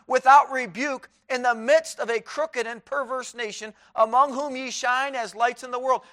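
loud fervent pulpit preaching; preaching at full force. Acted. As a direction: thunder the verses from the pulpit.

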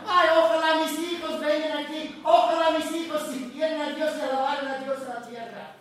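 voz alta y emocionada de madre en el cielo; mamá en el cielo. Retold or imitated. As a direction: imita a la madre que suplica alegre desde el cielo. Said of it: "no está diciendo". (madre en el cielo que ruega por sus hijos, voz alta y animada)

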